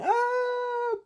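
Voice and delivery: very high, thin mock death cry; long hammed-up dying wail. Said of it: "They're dying".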